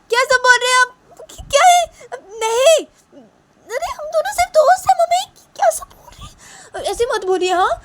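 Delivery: very high, loud squealed outrage; हैरान, नाराज़ बेटी. Acted. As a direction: squeal in shocked protest at your parents. (बहुत ऊँची, चीखती आवाज़ में विरोध)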